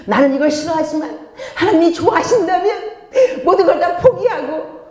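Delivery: high, fervent praying voice; put-on pious fervour, pitched high; mock devout prayer. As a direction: overdo the self-sacrificing plea. Acts out self-denying prayer.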